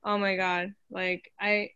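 usual speaking voice

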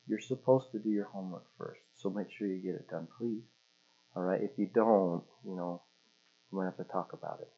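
put-on soft, low-key parent correcting a child; calm, hushed fatherly talking-to; gentle dad. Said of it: "Dad, uh, wants me to do things".

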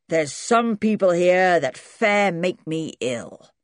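put-on slow, loud old granddad; disgruntled old man. A narrator's reading. Great-granddad heading out past the visitors, slowly grumbling.

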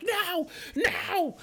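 very high, loud repeated cries; hammed-up high-pitched protest; mock yelling. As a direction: imitate a tackled man yelling in panic. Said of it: "just yelling".

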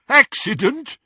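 high, loud, incredulous question; jeering echo. A narrator's reading. Henchman echoes the boy's excuse, jeering loudly. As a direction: throw it back as a loud mocking question. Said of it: "There was more jeering laughter".

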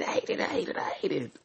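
ordinary talk

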